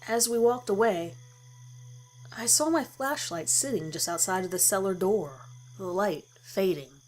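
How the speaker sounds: ordinary delivery, nothing marked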